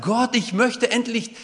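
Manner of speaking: loud, high pleading cry to God; David crying out to God, loud and pleading. Acted. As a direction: plead aloud like a man at the end of his rope. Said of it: "zu Gott geschrien und sagt".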